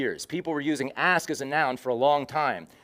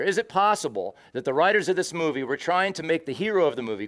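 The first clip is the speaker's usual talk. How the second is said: ordinary talk, nothing marked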